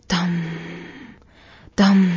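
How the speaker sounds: a loud, heavy boom like a drum; booming drum beat. A narrator's reading. Narrator booms the drum sound of Moria loudly. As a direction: thump the word out like a war drum.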